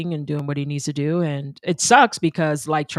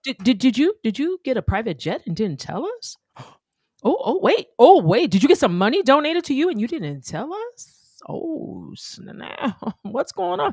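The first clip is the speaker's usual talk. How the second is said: mock surprise; 9 semitones higher than usual. Mock-shocked jabs at AOC.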